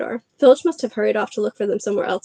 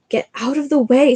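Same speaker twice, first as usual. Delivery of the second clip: sharp, louder snap; snapped; 4 semitones higher, 4 dB louder than usual. Acted. Ron snapping at Peeves in the corridor, sharp snap. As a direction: bite off the words at Peeves. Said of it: "snapped Ron".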